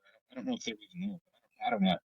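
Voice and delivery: usual speaking voice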